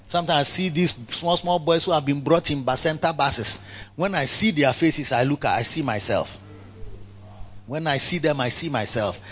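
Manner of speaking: ordinary delivery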